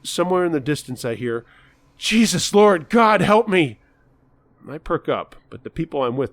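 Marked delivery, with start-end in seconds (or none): 2.0-3.8 high, loud pleading cry to God; distant desperate yelling. Acted. Madman yelling for Jesus outside the store, desperate loud cry. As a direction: scream for Jesus to save you.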